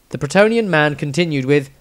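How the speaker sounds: ordinary delivery, nothing marked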